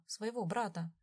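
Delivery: usual speaking voice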